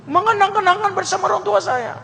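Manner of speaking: high falsetto woman saying no; refusing woman's voice. Acted. Woman refusing to leave her town, high falsetto. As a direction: squeak the refusal like a pouty girl.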